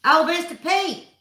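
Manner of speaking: excited greeting. Real, not acted. Greeting a favorite viewer.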